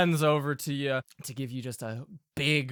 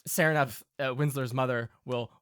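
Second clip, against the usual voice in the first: ordinary delivery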